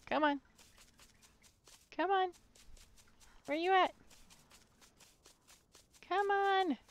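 high, sing-song come-on taunts; taunting call. Acted. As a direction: bait the monster with a high teasing call. Rat taunting the monster to chase.

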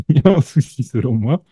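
quick repeat through a laugh. Real, not acted. Host teasing listeners again, laughing. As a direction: rush it out laughing at your own joke.

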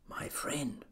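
hushed, whispered address; barely audible, as from a dust speck; faint tiny voice. A narrator's reading. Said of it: "The voice was so faint".

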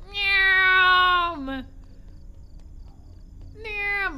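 loud high playful meow; drawn-out mock meow; cat meow. Meowing like cat.

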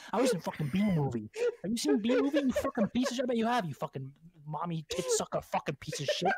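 building from low menace to loud abuse; hammed-up furious film star rant; acted angry tirade. Acted celebrity tirade of insults.

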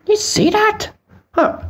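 squeaky high astonished question; surprised. Acted. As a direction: gasp it out in shock. Astonished at moving poop.

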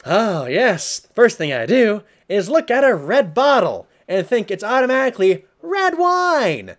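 high, loud sarcastic rant. Real, not acted. Ranting at the note writer, high and mocking. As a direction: rant at his obliviousness.

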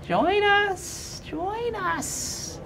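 very high, eerie wailing chant; many snarling voices overlapping. Acted. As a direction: wail the call like ghosts. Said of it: "the three heads say in unison".

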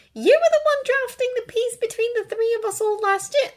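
put-on high, loud, pleading girl's voice; protesting Hermione. A narrator's reading. Hermione reminding Draco of last year, protesting. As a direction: reason with him in a high voice.

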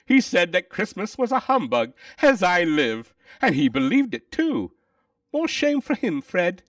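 high, loud character voices; animated, high-pitched family banter; amused indignation. Acted. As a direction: exclaim it merrily, pitched well up. Nephew and wife after laughing.

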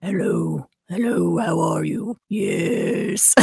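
very low, soft rumbling hello; deepest voice. Shown as a demonstration. Answering a fan's challenge, in her deepest voice. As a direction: drop to the bottom of your range. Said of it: "here's my deepest voice".

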